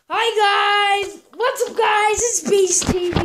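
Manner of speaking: over-the-top hammed-up intro shout; hyped intro voice. Shown as a demonstration. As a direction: pump it up like a cheesy channel intro. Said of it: "I just don't wanna say, let's see, like".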